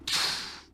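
breathy unvoiced pff of disappointment; deflated wolf cub's sigh; weary sigh. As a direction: let out a tired little sigh. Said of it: "soupire Max".

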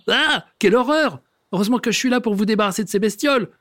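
high, fast fussy rant; disgusted neighbour. Acted. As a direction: recoil from the mice, then rush on.

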